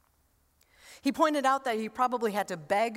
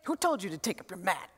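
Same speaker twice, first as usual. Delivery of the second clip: lightly voiced accuser; 5 semitones lower, 4 dB quieter, faster than usual. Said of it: "they say".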